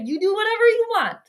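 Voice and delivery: high, fast sarcastic dismissal. Real, not acted. Fan dismissing Chicago's choice, sarcastic. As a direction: brush them off mockingly.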